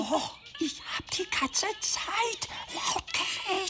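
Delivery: whisper. Acted. Whispers thinking he's loud.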